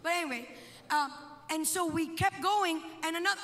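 ordinary talk, nothing marked

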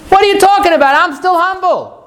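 yelling in disbelief, hammed up for the class; retold scream. Teacher acts out the rabbi shouting up in yeshiva, a high mock yell. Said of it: "and he screams".

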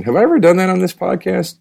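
ordinary delivery

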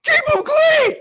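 full-throated high shout. Real, not acted. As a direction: yell it at the top of your lungs. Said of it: "All right, sorry".

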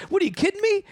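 high, animated, playful protest; excited disbelief. Retold or imitated. Justin teasing the producer for not interrupting lunch, excited. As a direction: brush off the apology, all high energy.

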